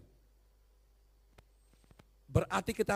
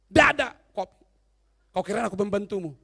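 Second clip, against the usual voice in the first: angry wife; 7 dB louder than usual. Retold or imitated. Preacher voices the angry wife.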